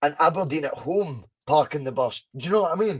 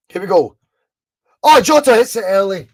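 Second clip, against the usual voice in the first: loud, higher TV match commentator call; louder, rising excited commentary voice; Jock Brown impression; 5 semitones higher, 11 dB louder than usual. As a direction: belt it out like a Scottish football commentator. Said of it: "I'll do my best Jock Brown impression".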